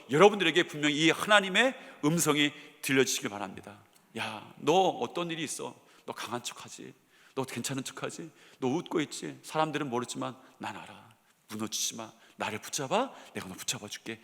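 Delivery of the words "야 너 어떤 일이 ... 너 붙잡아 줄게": much softer, lower, intimate and gentle; soft tender voice of God. Retold or imitated. Preacher voicing God comforting a struggling believer, hushed and tender. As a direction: speak it softly like a father comforting his son. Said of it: "하시는 말씀이 이 오늘 말씀인 것이죠".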